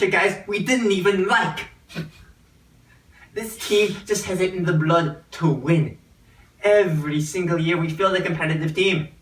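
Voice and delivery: hammed-up Irish brogue, Celtics rep pitch; a stage Irishman selling the Celtics; bad Irish accent. Celtics rep pitches a fan in a bad Irish accent. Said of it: "the worst Irish accent I've ever heard".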